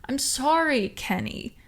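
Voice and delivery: high whiny apology; a hint of a whiny partner pleading; whined. A narrator's reading. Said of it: "the G Z whined".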